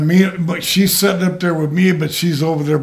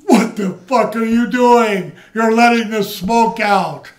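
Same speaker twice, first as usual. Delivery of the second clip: high mock furious yell, slowed; Sheik yelling; 8 semitones higher, 3 dB louder than usual. Sheik yelling at her in the car, mock furious. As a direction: ham up the outrage. Sheik quoted again in story.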